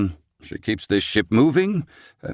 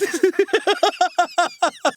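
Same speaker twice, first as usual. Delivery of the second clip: a high, delighted burst of laughter; gleeful laughter. Acted. Old scholar can't contain joy at the unknowns, laughing gleefully. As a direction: laugh out, unable to hold it in. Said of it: "my laughter spills forth from the inside".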